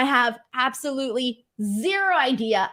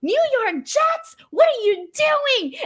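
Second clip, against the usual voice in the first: much higher, slow mock yell of a bus driver; teasing bus driver; 14 semitones higher, 3 dB louder, slower than usual. Bus driver teasing her Jets sweater, slow high mock yell. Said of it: "he goes".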